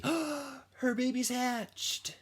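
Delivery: amazed at the news; high, slow astonished announcement; gasp of surprise. Acted. Surprise at the hatching.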